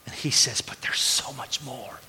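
hushed whisper, slowed. Retold or imitated. Pastor quoting his friend's longing, whispered. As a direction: breathe it like a secret. Quiet aside about friend.

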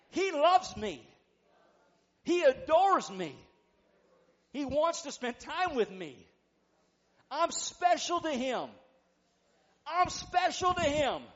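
high, loud phrases for echoing; loud preached declarations. Real, not acted. Preacher leading the congregation in declarations, full-force preaching. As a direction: proclaim each line with full conviction.

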